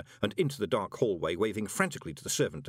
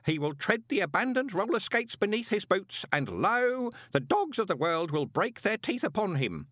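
higher voice, wavering between doubt and conviction; musing postman; 6 semitones higher than usual. A narrator's reading. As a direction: argue it out with yourself. Same postman arguing with himself.

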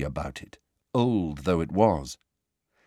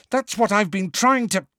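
high-pitched exasperated retort, cut short; exasperated, breaking off mid-sentence; 11 semitones higher, 3 dB louder than usual. A narrator's reading. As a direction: protest in frustration at a raised pitch.

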